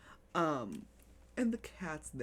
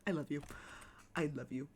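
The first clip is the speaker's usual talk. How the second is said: usual speaking voice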